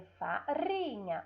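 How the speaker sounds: "farinha" slowly, with a rolled R; tongue-tip trill in "farinha"; weak trembling R. Shown as a demonstration. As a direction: pause "fa-rinha" and trill the R. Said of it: "Ó, tremeu".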